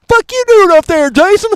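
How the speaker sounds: high, loud mock of the trailer line; high mocking parody; mocking imitation. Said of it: "quoting trailer line".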